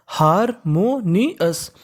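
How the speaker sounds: Harmonious very slowly, each part on its own; teacher slowly sounding out harmonious; syllable by syllable. Shown as a demonstration. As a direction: split harmonious into pieces with pauses between.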